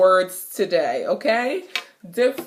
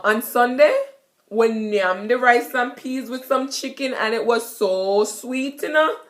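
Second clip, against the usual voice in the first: Jamaican patois; 3 semitones higher than usual. Shown as a demonstration. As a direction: tell about Sunday dinner in patois. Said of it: "you could say".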